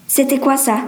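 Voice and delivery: ordinary delivery, nothing marked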